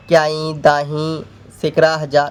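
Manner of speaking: slow, level recital of "क्याई दाही सिकरा हज़ार"; place-value recitation. Shown as a demonstration. Teacher reciting place values to students, slow counting-off. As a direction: count off each place name slowly. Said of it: "जैसे ऐसे बोल सकते हैं".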